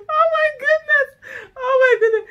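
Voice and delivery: high and loud, gasping through laughter; laughing hard. Real, not acted. Wife recovering from a laughing fit, laughing. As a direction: overcome with laughter. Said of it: "I normally laugh with reckless abandon".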